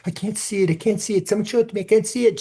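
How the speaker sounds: high, pleading mock sob of a girl; imitating crying girl. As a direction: play the girl begging to see it. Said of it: "and this girl is crying".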